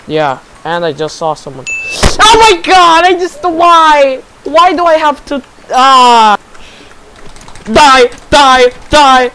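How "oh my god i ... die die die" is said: loud high furious yelling; raging gamer. Real, not acted. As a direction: rage at the game. Raging at losing fight.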